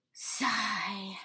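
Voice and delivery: whispered sigh. Acted. Reads the sigh aloud.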